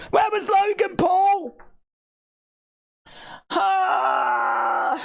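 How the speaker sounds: high, hammed-up wailing over a loss; mock wailing. Real, not acted. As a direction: wail it like a heartbroken fan. Fan wailing over wrestling result.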